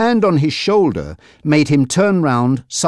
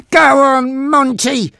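high, loud order to attack; angry shout; 14 semitones higher, 4 dB louder, slower than usual. A narrator's reading. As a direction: shout it angrily to egg on a henchman. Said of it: "Frankie shouted angrily".

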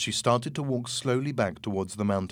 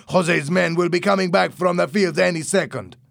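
loud, high, urgent warning; shouting back; 7 semitones higher, 7 dB louder than usual. A narrator's reading. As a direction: yell the warning over to him. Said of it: "Louis shouted back".

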